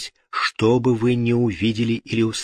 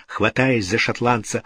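ordinary delivery, nothing marked

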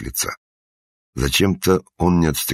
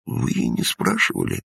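playing dumb; 12 semitones higher, 3 dB quieter than usual. A narrator's reading. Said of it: "сделал глупое лицо старик".

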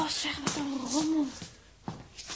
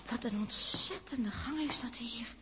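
whisper; 10 dB quieter than usual. Acted. Eerie empty corridor aboard ship.

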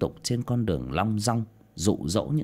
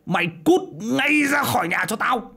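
"mày cút ngay" screamed much higher and louder; furious shout; 13 semitones higher, 5 dB louder than usual. Acted. Father screaming at his daughter to leave the house, full shout. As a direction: explode and throw her out. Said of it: "mà mắng xối mắng xả".

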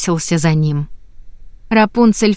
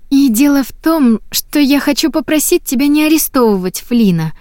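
sweet pleading; 7 semitones higher than usual. A narrator's reading.

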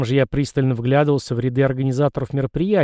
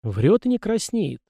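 higher, slow, scornful aside; snorting; 6 semitones higher, slower than usual. A narrator's reading. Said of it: "фыркнул осмелевший Денис". (насмешливое фырканье)

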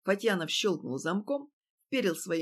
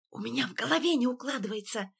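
agitated whisper; 4 semitones higher than usual. A narrator's reading. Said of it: "зашептал Фатьянов".

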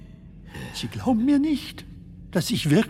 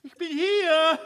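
higher voice calling from far off; distant call; 8 semitones higher than usual. Acted. Stranger calls out to the other man in the dark, distant. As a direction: announce yourself from a distance.

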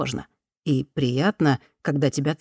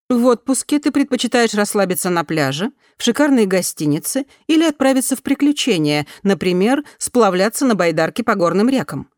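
higher, louder, polished newsreader tone; news reader voice; 4 semitones higher, 3 dB louder than usual. A narrator's reading. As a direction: read it like a news bulletin. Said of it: "как из сводки новостей". (голос диктора новостей)

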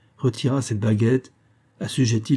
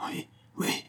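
very soft, voiceless moan of assent; gasping in agony; 12 dB quieter than usual.

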